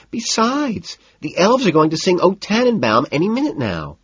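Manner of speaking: put-on high, insistent party-girl pleading; fussy high-pitched excuse; whiny hostess. A narrator's reading. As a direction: protest in a high, petulant voice. Evelyn refusing to leave.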